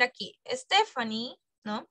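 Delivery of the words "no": ordinary talk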